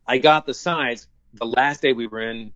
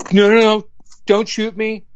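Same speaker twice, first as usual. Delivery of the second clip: acted audition line; 8 semitones higher, 4 dB louder than usual. Acts out his audition line.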